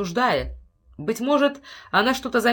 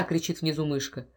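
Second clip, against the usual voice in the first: ordinary delivery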